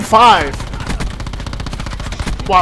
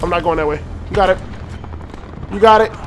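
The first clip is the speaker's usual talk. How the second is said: ordinary talk, nothing marked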